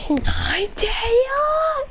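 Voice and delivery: high, slow creature wail; Pokemon cry. Acted. Ninetales crying out in battle, high and drawn out. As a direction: wail the name out long like a fox spirit. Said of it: "As he goes".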